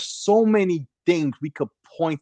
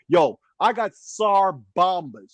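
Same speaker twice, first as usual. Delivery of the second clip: slow emphatic; 2 semitones higher, slower than usual. Acted. Hyped threat, repeated.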